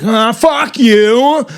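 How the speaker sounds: "fuck you" slow and swooping, pitched high; sing-songy. Shown as a demonstration. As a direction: stretch "fuck you" in an up-and-down swing. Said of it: "he'll go up, and then he'll go down".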